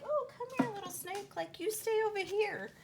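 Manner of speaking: high, sing-song sarcastic cooing; mock coaxing. Pretending to sweet-talk a little snake, mock coaxing. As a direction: coax in an exaggerated high little voice.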